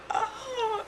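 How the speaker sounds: very high, soft drawn "oh"; mock amazement. Moviegoer as the costume starts glowing, high mock amazement. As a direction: squeak out an awed little oh.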